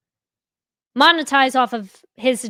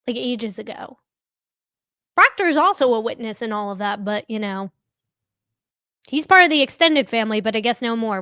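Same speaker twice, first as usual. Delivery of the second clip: ordinary talk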